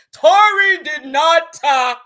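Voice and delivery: loud, high, drawn-out imitation; hollering. Imitating a father hollering outside the courthouse, mock shout. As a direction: holler each word slowly, mocking. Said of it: "your daddy out here hollering".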